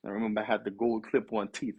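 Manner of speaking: ordinary talk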